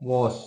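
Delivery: वॉश with the open rounded ऑ, as in wash; example word. Shown as a demonstration. Teacher showing students the borrowed vowel, precisely. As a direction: say वॉश carefully, vowel between आ and औ. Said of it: "जैसे हम कहते हैं".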